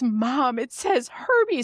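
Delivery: put-on high, slow child voice; imitating daughter. Daughter reading the wrong name to mom, imitated high child voice. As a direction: pitch up like a kid reporting to mom. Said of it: "and she goes".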